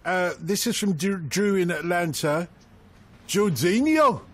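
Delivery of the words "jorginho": put-on higher-pitched go at a Geordie accent; attempted Geordie accent. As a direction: put on a mock Geordie lilt. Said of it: "pronounced in suitable accent, please".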